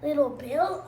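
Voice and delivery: plain reading voice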